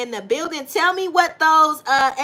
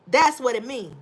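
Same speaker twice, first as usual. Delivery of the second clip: ordinary delivery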